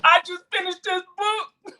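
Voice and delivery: high, put-on teary voice of the crying man; imitating weeping reviewer. Retelling the Instagram reviewer's tears, high and mock-teary. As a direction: mimic him choking up over the book. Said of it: "He was like".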